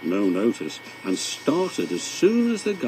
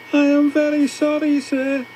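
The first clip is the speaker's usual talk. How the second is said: high, louder, humble apology; contrite engine voice; 5 dB louder than usual. A narrator's reading. James apologising to the Fat Controller, high and humble. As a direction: sound small and sorry to the boss. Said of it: "said James".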